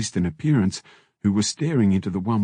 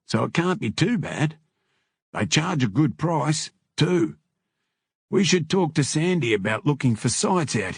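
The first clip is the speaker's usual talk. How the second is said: higher-pitched character voice; club member; 6 semitones higher than usual. A narrator's reading. Bikers discussing a rival business, lightly higher character voice. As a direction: lift the register for biker shop talk.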